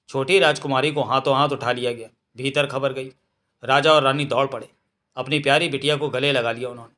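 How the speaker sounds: ordinary delivery, nothing marked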